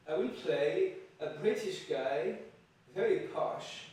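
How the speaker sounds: hammed-up posh British accent; bad British accent. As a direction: try a plummy British accent and only half manage it. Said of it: "my British accent is not very good".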